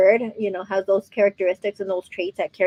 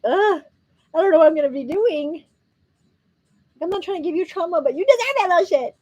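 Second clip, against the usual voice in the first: mock exasperated mom; 8 semitones higher than usual.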